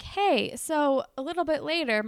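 a light guy voice calling out; cute boy inviting. Retold or imitated. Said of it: "he's like".

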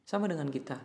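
ordinary talk

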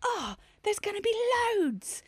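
high-pitched gasp of discovery; excited realisation. Retold or imitated. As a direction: act out a thrilled dawning thought. Acts out sudden realisation.

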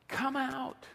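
high, held summons; calling out. Acted. Preacher voices Jesus summoning the congregation, high call. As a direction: call it high, without adding volume. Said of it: "the same voice that called Lazarus".

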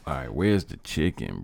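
normal talking voice

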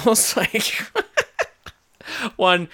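usual speaking voice

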